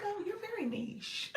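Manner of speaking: high, mocking condescension; snide comment. Streamer imitating contact's snide niche comment, high and mocking. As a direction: sneer it sweetly, talking down. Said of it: "a little snide comment".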